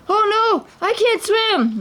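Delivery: very high terrified wail. A narrator's reading. The thief wails as the snake dives into the sea. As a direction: panic before the water. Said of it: "Muhammad wailed".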